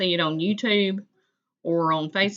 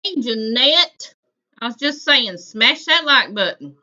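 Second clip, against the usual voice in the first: calling out loudly; 5 semitones higher, 7 dB louder than usual. Real, not acted. Greeting a chatter, urging likes.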